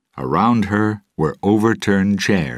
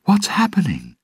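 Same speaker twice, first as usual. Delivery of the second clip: high, breathless whisper; 3 dB louder than usual. Acted. Elizabeth asking Will in alarm, whispered. Elizabeth frightened, prisoner brought in.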